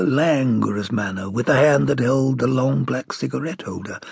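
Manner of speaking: plain reading voice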